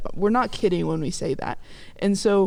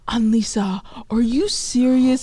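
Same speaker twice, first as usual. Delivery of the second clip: slow, rising indignant disbelief, imitated; pissed-off mom; 3 semitones higher, 4 dB louder, slower than usual. Mom hearing daughter will visit a friend instead, pissed. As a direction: stretch it out like an exasperated mother.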